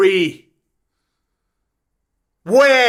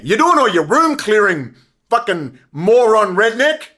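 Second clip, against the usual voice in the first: ordinary talk, nothing marked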